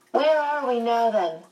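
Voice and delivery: high-pitched start, dropping on the last word; demanding child. A narrator's reading. Charles asking where they are, in a high, demanding voice. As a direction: insist on an answer, small-boy style. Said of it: "Charles Wallace demanded".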